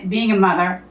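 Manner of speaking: usual speaking voice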